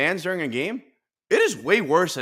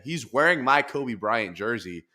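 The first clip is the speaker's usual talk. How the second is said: usual speaking voice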